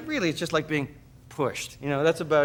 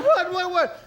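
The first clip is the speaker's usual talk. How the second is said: high, loud mock panic; wife sputtering in confused panic; frantic; 4 dB louder, slower than usual. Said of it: "She's going".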